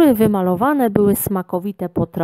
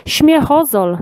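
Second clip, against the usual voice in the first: higher, lightly exclaimed; called out; 7 semitones higher, 3 dB louder, slower than usual. A narrator's reading. Man shows them his bottle, higher-pitched exclamation. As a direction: announce it in a raised pitch. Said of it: "zawołał".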